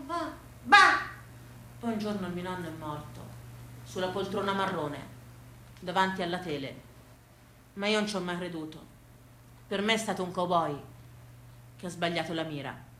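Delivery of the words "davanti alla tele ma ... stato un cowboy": usual speaking voice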